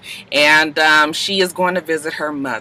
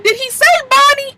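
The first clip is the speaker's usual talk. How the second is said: high, shocked disbelief; can't believe what he said; incredulous; 3 dB louder than usual. Retold or imitated. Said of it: "I was like".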